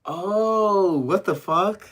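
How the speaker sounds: loud, high, drawn-out "oh" of disbelief; real shock, voice leaping up; startled realization. Real, not acted. Streamer spots the Hello Kitty thing in his closet, startled.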